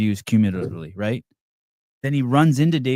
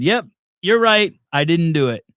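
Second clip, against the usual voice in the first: retold concession; 6 semitones higher, 6 dB louder than usual. Acting out a wished-for concession.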